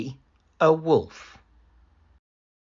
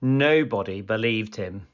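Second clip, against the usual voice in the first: ordinary delivery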